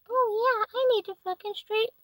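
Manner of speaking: high, whiny mocking voice; mock quote. Mimicking the other parents in a high whiny voice. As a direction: squeak it up high like a clueless parent. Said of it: "And they were like".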